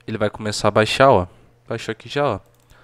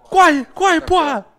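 being chased; 5 dB louder than usual. Real, not acted. Fleeing monster, laughing yells.

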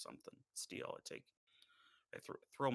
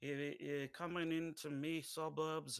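put-on a clumsy, slightly higher stab at a Cockney British accent; bad British accent; 6 semitones higher, 4 dB louder, slower than usual. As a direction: fumble a cheeky British street-kid accent. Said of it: "it's not my British accent. It's, that was terrible".

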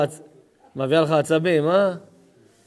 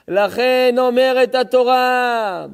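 slow, loud, higher sermon cry; preaching at full force; 5 semitones higher, 5 dB louder, slower than usual. Acted. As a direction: drive the verse home from the pulpit. (דרשה בקול רם ואיטי)

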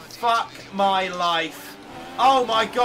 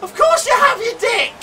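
raging gamer; 9 semitones higher than usual. Real, not acted. Mocking teammate.

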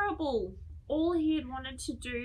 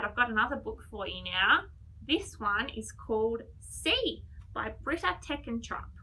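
plain reading voice

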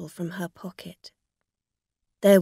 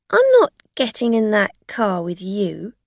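loud, firm, outraged; indignant refusal; 2 semitones higher, 8 dB louder than usual. A narrator's reading. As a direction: refuse point-blank. Angry woman refuses the lift.

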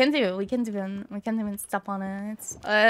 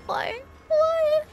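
high wailing why; mock wail. Gamer wailing at the game's cruel twist. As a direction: wail it in hammed-up despair.

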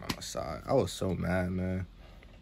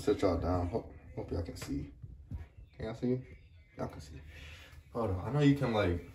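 fabric over the mouth; slightly muffled by cloth; through a ski mask; 4 dB quieter than usual. Real, not acted. Seen 1: Knit balaclava covers mouth throughout; pulled off after 11 seconds.

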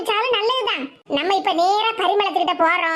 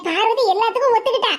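usual speaking voice

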